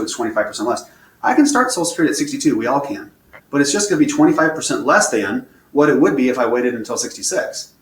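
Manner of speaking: ordinary talk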